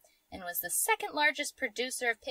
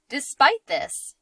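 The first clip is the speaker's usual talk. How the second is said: slow, laughing through the words; trying not to laugh; 4 semitones higher, 7 dB louder, slower than usual. Real, not acted. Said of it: "I'm not even trying, it just keeps coming out".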